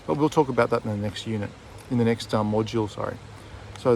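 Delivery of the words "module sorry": ordinary talk, nothing marked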